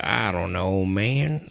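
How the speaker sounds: put-on low creepy old man; low, aimless old-timer talk. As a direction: drop the voice into a creepy old man's. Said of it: "he talks like a creepy old man".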